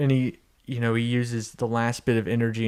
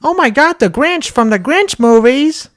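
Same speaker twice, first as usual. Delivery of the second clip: high, loud cartoon wife impression; hammed-up shock, pitched way up; Lois; 13 semitones higher, 10 dB louder than usual. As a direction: exclaim it in a high put-on voice. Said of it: "Lois is like".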